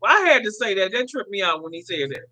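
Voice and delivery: ordinary delivery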